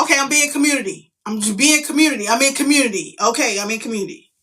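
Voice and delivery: hammed-up assurance under provocation; insistent mock compliance. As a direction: insist you are behaving, exasperated. Acting out insistence to her daughter.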